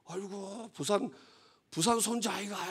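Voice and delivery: light imitation of a Jeolla granny recognising him; Jeolla dialect grandmother. Grandmother recognising her grandson from Busan, lightly imitated Jeolla dialect. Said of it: "그 전라도 말로 뭐래요?".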